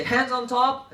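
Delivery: teacher call. Shown as a demonstration. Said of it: "So I say".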